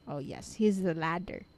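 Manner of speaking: ordinary talk, nothing marked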